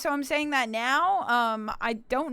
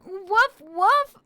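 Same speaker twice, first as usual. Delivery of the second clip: high, loud playful woofs; puppy barks; 6 semitones higher, 7 dB louder than usual. Pretend. Streamer barking at chat as a puppy, high and loud. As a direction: bark like a happy puppy. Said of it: "But I'm a puppy".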